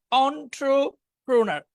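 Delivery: "Entrepreneur" very slow, in drawn-out chunks; syllable-by-syllable pronunciation. Shown as a demonstration. Teacher modelling the hard word "Entrepreneur", slowly in parts. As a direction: break "Entrepreneur" into on-tro, then pro-nar. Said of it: "একটু কঠিন".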